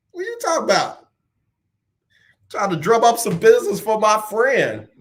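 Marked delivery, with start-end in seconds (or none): 0.1-1.1 mock protest.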